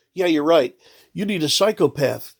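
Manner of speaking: ordinary talk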